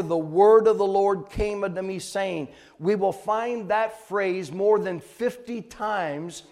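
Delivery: forceful, loud sermon delivery; preach. Acted. Preacher declaring God's word to the congregation, forceful preaching. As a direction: preach it out with hard, loud emphasis on key words.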